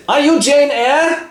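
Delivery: high, slow frail question; dying aunt. Acted. Dying aunt greeting Jane, high and slow. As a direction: ask weakly from the deathbed.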